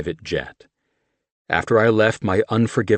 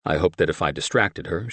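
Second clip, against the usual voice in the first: usual speaking voice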